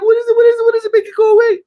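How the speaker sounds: high, loud frightened pleading; retold girlfriend begging in fear; terrified pleading. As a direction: beg in panic for it to go. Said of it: "She's like".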